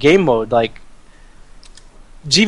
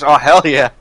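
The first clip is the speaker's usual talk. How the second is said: talking while crunching croutons; 3 dB louder than usual. Real, not acted. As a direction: mouth full of crunchy croutons. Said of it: "Okay, I heard it that time".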